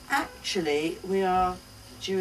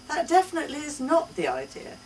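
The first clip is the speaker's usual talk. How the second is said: put-on indignant child; 9 semitones higher, 4 dB louder, faster than usual. A narrator's reading. Mother voicing daughter's protest.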